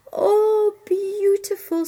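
high, loud, drawn-out rapture; gushing admiration. Acted. Young servant mistakes frogs for the nightingale, gushing. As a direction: swoon over the lovely sound.

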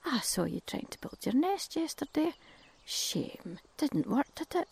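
a cheeky neighbour poking fun; woodpecker voice. Acted. Woodpecker teasing cuckoo about the failed nest. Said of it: "The woodpecker says".

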